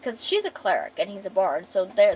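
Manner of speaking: normal talking voice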